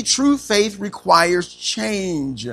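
slow emphatic. Shown as a demonstration. Preacher stating outline point slowly.

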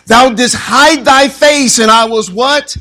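high, loud and slow, then a rising prompt; preaching at full force. Acted. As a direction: call the verse out and wait for the answer. Preacher reads psalm, prompts crowd.

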